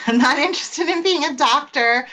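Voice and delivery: laughing through the words. Retold or imitated. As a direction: laugh while saying it. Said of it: "so I always told my dad, like".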